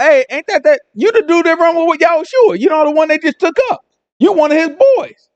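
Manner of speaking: high-pitched voice of a nosy onlooker; acted recognition. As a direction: call him out in mock recognition. Second accuser spots Peter, acted.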